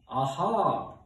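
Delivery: thunderous storybook "aha"; thundering god. A narrator's reading. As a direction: boom it out like a god. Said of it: "thundered the voice of Guy".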